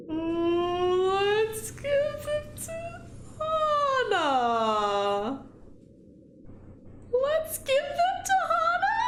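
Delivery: very high, drawn-out dithering; hesitant high squeal. Real, not acted. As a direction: stretch it out in a nervous high voice. Agonises over a weighty choice.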